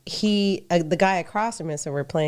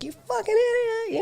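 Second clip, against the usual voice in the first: high, sneering insult; drunk angry yell; faster than usual. Retold or imitated. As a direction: bark it at the woman like a furious drunk. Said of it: "he's yelling at the woman".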